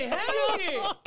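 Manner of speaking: high, loud, thrilled agreement; eager shout. Retold or imitated. Comedian agrees to a fan's photo, eager shout. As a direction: holler your yes. Retold eager yes to fan.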